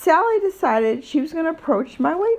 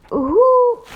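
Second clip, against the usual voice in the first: outraged; 12 semitones higher, 7 dB louder than usual. Real, not acted. Furious rant about Sally's judgment.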